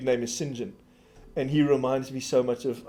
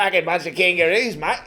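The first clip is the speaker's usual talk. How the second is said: hammed-up Aussie caricature, slightly raised; Australian accent; 4 semitones higher, 3 dB louder than usual. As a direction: put on a mock Australian twang. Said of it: "they've moved to Australia".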